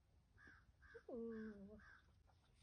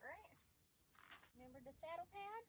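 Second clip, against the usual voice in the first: high coaxing; 9 semitones higher, 8 dB quieter than usual. Real, not acted. Coaxing horse toward saddle pad.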